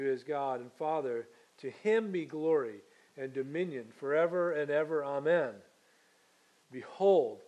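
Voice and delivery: solemn, measured reading of Revelation; scripture reading. Acted. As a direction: read it as holy scripture, unhurried. Scripture reading at service start.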